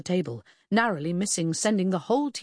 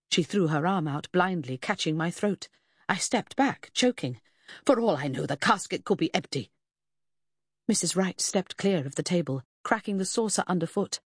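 ordinary delivery, nothing marked

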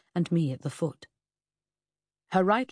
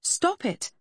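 much higher sharp cry; 13 semitones higher than usual. Acted. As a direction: cry it out to stop him. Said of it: "I cried".

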